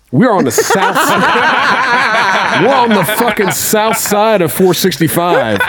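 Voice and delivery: high, slow, laughing disbelief; laughing at the absurd detour. Real, not acted. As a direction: laugh through the words. Laughing at wrong turn.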